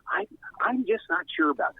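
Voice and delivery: a hint of a put-on on-air host voice; Duffy. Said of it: "learned how to do a Duffy accent".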